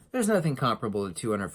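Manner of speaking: usual speaking voice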